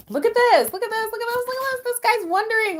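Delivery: high-pitched repeated exclamation; real excitement. Real, not acted. Reader seeing the card about this guy, excited squeal.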